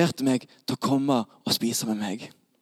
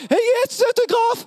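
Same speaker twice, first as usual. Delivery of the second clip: high-pitched excited fan shout; autograph hunter calling; 4 dB louder than usual. Acted. Autograph hunters running to Jesus, high excited shout. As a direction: call out way up high like a mobbing fan.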